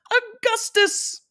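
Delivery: very high, drawn-out fluty cry; slow, piping squeal of welcome; delighted cry. A narrator's reading. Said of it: "cried Mister Wonka".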